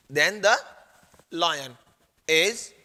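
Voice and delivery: word by word with long pauses; halting write-along delivery; dictating. Real, not acted. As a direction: dictate THEN THE, LION, IS in separate pieces. Teacher dictates words while writing.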